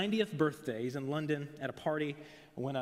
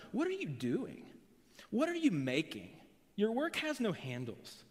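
proud questioning; 6 semitones higher than usual. Retold or imitated. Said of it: "Can you hear the proud questioning".

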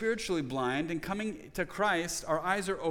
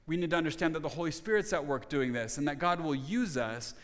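ordinary delivery

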